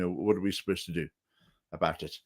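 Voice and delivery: ordinary talk, nothing marked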